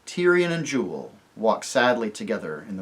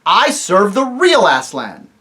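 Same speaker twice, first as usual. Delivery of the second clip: higher, loud and slow; angry king; 7 semitones higher, 12 dB louder, slower than usual. Acted. Tirian insists to the dwarfs, loud and angry. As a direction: declare it with anger.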